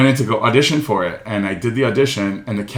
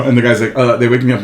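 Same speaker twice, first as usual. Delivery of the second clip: ordinary talk, nothing marked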